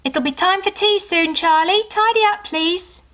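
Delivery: bright mother calling up the stairs; mum calling. A narrator's reading. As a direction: call her daughter in for tea. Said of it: "Mum said".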